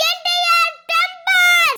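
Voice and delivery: slow, loud, punched out; shouted slogan. Acted. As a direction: bellow the title slowly.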